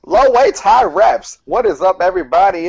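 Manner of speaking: high, loud gym-bro hype yell; hyped catchphrase shout. Pretend. Greeting the podcast listeners, loud high hype shout. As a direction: pump the crowd up with a big call.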